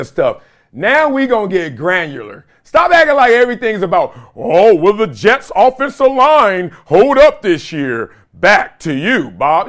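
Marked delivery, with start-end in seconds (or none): 4.3-9.7 slow, hammed-up announcer voice, a bit higher and louder; mock stuffy broadcaster. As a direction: parody a dull sports anchor asking a stock question. Mocking boring sports broadcast talk.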